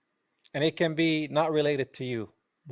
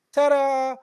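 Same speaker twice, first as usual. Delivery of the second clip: high, loud sarcastic ta-da; mock triumphant reveal; 11 semitones higher, 6 dB louder than usual. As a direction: present it like a cheap magic trick. Mocking a proud reveal.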